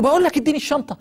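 high, loud, irritated demand; angry snap. Retold or imitated. Dying passenger snaps at his neighbour for his bag, angrily. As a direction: bark it out like a man losing his temper. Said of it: "يتعصب عليه".

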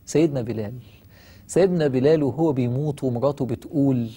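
usual speaking voice

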